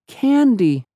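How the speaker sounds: put-on high sweet childlike voice; small girl asking simply; innocent girl voice. Unicorn girl voice.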